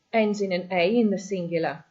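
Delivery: ordinary talk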